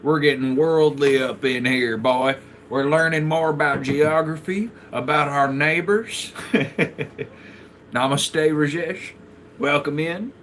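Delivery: loud, hammed-up Southern accent; hard Southern accent. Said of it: "hitting the hard Southern accent".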